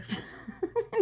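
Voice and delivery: high, soft giggle; amused laugh. Real, not acted. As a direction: giggle at a funny misspelling. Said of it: "tu m'as fait rire".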